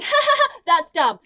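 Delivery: high, loud fake laughing dismissal; mock scornful laugh. Mimicking the heroine laughing it off, mock-scornful. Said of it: "she literally is just like".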